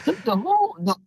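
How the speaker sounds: high, halting incredulity; acted disbelief. Passer-by who can't believe the door is gone, incredulous. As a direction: stumble over the words in mock astonishment.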